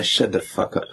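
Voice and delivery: usual speaking voice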